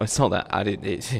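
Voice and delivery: usual speaking voice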